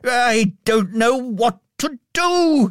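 high, slow giddy cry; overjoyed old man calling out at once; shouted, laughing and crying. A narrator's reading. Scrooge wakes alive and cries out overjoyed, high and giddy. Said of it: "he shouted, laughing and crying".

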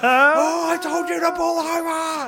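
high, loud roadside shout; dad shouting after the car as it drives past; furious shout. Retold or imitated. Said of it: "on the side of the road going".

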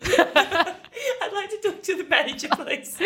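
Karen voice. Pretend. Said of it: "My name is Karen".

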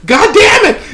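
high, loud hammed-up exasperation; cursing. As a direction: curse at the awful stench in mock agony. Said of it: "cursing Dallas's name".

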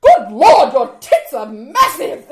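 loud hammed-up leer; mock leering shout. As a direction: belt it like a cheeky lech. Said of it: "He was like".

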